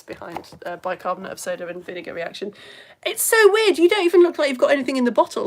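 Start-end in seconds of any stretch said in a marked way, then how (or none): 3.1-5.5 loud, high burst of wonder; amazed. Real, not acted. Presenter reacting to a viewer's magic-looking bottle, amazed. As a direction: marvel out loud, quickening as you go.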